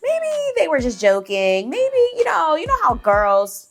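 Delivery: high, sing-song brush-off with a mild mock drawl; mocking the admins. Teacher mimicking admins brushing off the complaint, mock sing-song. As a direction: imitate a dismissive boss, lightly sneering.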